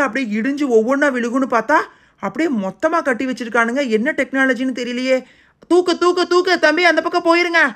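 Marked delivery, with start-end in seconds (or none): 5.7-7.6 higher and louder, pushing through the lift; straining heave shout. Acted. Uncle heaving the wall off the trapped boys, loud straining shout. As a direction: call it out mid-effort as you heave the weight.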